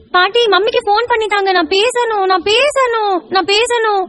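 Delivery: put-on high, whining kid's demand; high little voice, drawn-out nagging; stubborn child. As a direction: insist like a small child throwing a fit. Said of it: "இப்படி அடம் பிடிச்சீங்கன்னா".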